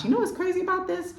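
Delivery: high, fast, delighted segue; excited. Real, not acted. Host plugging her own program to viewers, high and thrilled. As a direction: gush about the happy coincidence.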